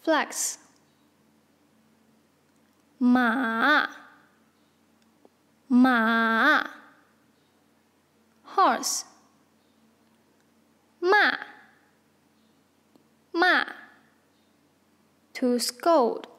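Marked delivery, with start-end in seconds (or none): 3.0-6.7 马 in a dipping third tone; drawn-out 马 with a low dip; tone demonstration. Shown as a demonstration. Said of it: "Tell us the tone of each". 11.0-13.6 骂 in a falling fourth tone; short 骂 with a sharp fall; tone demonstration. Shown as a demonstration. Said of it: "Tell us the tone of each".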